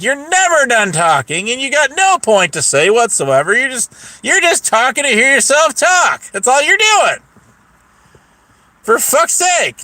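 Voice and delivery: rising, high-pitched telling-off; retold fed-up rant; exasperated scolding. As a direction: build exasperation as you scold. Acted scolding in retold quarrel.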